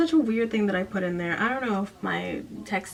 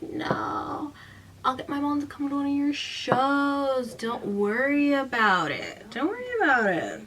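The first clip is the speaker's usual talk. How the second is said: put-on persuasion; 5 semitones higher than usual. Imitating her talking Erin round, put-on soothing. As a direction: imitate someone soothing away objections, stretching the words. Retold pleading, put-on spooky reassurance.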